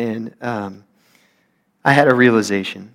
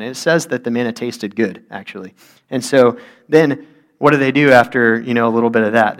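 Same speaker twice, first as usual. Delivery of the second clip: ordinary delivery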